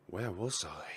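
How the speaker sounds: breathy unvoiced whisper of a lost man; whispered inner thought. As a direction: whisper it, dazed and unsure where you are. Reading the hero's inner line aloud.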